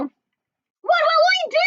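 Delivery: very high, slow, anxious duck voice; crying duck. A narrator's reading. Ducky Lucky crying out in worry to the others. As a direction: cry it like a worried duck. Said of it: "cried Ducky Lucky".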